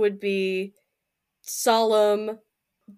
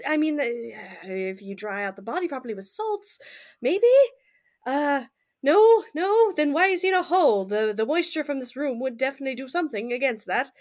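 usual speaking voice

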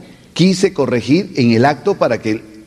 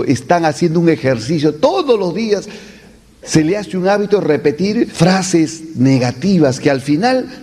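normal talking voice

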